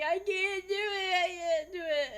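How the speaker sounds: high, loud mock wail; kindergartner crying out. Kindergartner failing to draw a six, loud mock crying. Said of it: "crying out".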